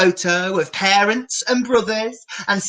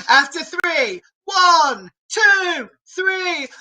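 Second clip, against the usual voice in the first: high, eager count in; excited countdown; 9 semitones higher, slower than usual. Acted. Presenter counting kids into the dance, excited countdown. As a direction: count them in like a party host.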